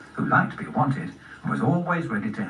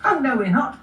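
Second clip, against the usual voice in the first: high-pitched contrary rabbit voice; squeaky sibling objection; argumentative brother rabbit; 12 semitones higher, 4 dB louder than usual. Acted. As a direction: contradict like a squabbling little rabbit.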